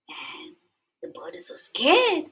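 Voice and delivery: hushed, scared whisper. A narrator's reading. Storyteller whispering to children, hushed. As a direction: breathe it like a secret. Narrator whispers bird's fear.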